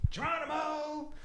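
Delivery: high, drawn-out Geronimo; gleeful Geronimo call. Retold or imitated. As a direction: call it out like a jumper leaping. Husband shouts before photo.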